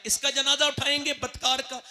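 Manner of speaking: ordinary talk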